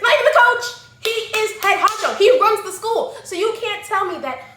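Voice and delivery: high, loud indignant outburst; building to near-shouting protest; worked-up rant. Real, not acted. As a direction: rant with rising exasperation. Heated rant defending her point.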